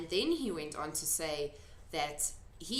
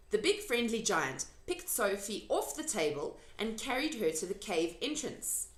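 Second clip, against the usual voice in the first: ordinary delivery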